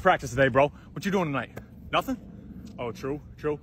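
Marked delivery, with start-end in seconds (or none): none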